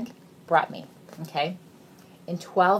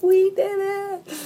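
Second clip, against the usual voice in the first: high, slow cheer of joy; excited cheer; 13 semitones higher, 7 dB louder, much slower than usual. Retold or imitated. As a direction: shout it out like a happy kid. Kids cheering in the car.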